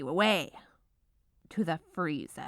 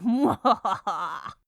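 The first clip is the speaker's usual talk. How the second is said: short hammed-up villain laugh; mock evil chuckle; 3 semitones higher, 6 dB louder than usual. Baker gloating over the bowl, mock evil chuckle. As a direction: chuckle like a cartoon schemer.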